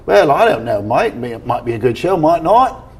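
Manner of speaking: mock English accent, lilting pitch. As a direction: put on an English voice as God answering. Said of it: "Talk like an English person".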